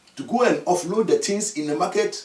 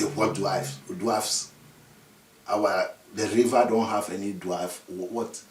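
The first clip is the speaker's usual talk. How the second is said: puzzled low mutter; 13 semitones lower, 6 dB quieter than usual. Acted. Chief puzzled, low and quiet.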